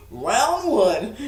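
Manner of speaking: loud fight call with a laugh; mock ring announcer. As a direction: announce the bout, playfully loud. Plays ring announcer after bell.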